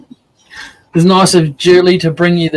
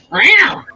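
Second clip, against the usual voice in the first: cat meow; 12 semitones higher than usual. Retold or imitated. Imitating the cat hollering.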